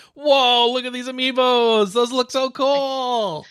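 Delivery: high, loud, drawn-out awe; excited gushing. Real, not acted. Co-host seeing the new amiibos, excited gushing. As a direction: gush loudly, stretching the last words.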